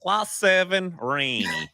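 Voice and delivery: high-pitched imitation of a Russian goalie; Brizzy. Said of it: "the way that he said it".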